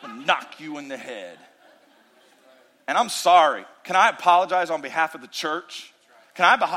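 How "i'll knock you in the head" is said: mock threat. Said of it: "portray God ... with the Louisville Slugger".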